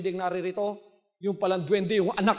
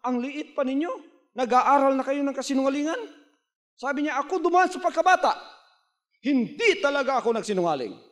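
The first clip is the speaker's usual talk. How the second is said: higher, scolding man's voice; scolding passerby; 7 semitones higher than usual. Acted. Man scolding children caught lying, higher scolding voice. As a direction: chide the kids like a shocked passerby. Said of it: "Sabi nung mama".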